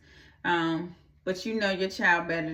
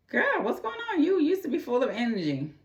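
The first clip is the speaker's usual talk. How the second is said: high, concerned friend voice, a bit fast; much higher, hammed-up worried friend; 9 semitones higher than usual. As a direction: squeal it like a worried girlfriend.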